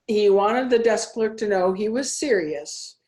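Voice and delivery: usual speaking voice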